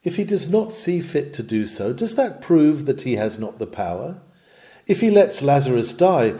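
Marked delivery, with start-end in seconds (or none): none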